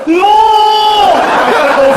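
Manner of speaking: high, long drawn-out wail; hammed-up cry of protest; mock cry. Dad hams up his arrest before son, mock wail.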